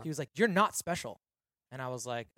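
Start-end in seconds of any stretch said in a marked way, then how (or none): 0.3-1.2 blunt put-down. Retold or imitated. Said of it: "He's sorta mean".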